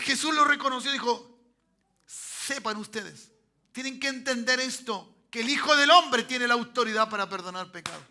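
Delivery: loud, high, building to a shouted declaration; fervent preacher at full volume; full-force preaching. Real, not acted. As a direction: proclaim it boldly to the whole church.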